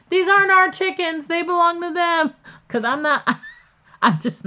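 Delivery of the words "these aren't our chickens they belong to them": much higher and louder, insistent disclaimer; mom denying. Retold or imitated. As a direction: mom loudly disowning the chickens. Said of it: "She's like".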